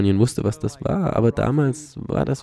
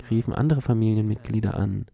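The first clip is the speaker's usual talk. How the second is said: ordinary delivery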